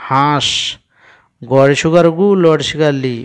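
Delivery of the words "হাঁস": হাঁস voiced through the nose; nasal vowel. Shown as a demonstration. Teacher repeats it so pupils hear, nasal a in হাঁস. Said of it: "নাক দিয়ে আওয়াজটা বার হচ্ছে".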